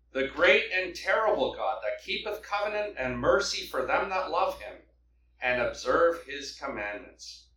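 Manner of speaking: ordinary talk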